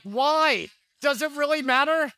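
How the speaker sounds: high, incredulous "why, does it really matter"; mock exasperated. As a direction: scoff at the question, pitched high. Pastor's retort to husband.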